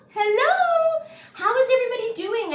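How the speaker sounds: high bubbly hello; excited greeting. Real, not acted. Streamer welcoming her live viewers, high and excited. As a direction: greet everyone with a burst of joy.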